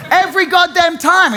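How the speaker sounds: high, loud fed-up outburst; exasperated shout. Real, not acted. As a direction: throw it out exasperated.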